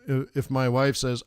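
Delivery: ordinary talk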